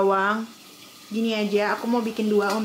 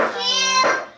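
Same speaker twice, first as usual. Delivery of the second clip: high, loud call of the name; 7 dB louder than usual. Real, not acted. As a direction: sing out his name. Mother calling to the child.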